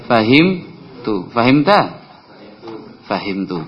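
ordinary delivery

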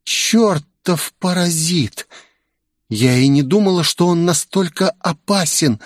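high-pitched, acted outrage; seething heroine cursing her rival; indignant rage. A narrator's reading. Olivia rages about her rival.

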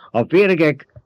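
high, loud, affronted argument; indignant protest. A narrator's reading. Baron insists the south is only sand, indignant. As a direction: object heatedly, pitch pushed up. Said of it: "méltatlankodott a báró".

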